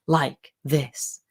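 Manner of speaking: slow, low, weighty delivery; really dramatic voice. Shown as a demonstration. Coach warning against overdoing it in meetings, slow dramatic voice. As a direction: space out each word for drama. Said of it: "talks with a really dramatic voice".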